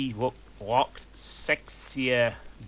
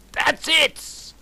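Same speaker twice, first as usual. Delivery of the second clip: higher, louder mock fury; mock angry outburst; 5 dB louder than usual. Fudge losing patience with the goths, mock fury. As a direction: snap it out like a hammy teacher. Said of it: "shooted angrily".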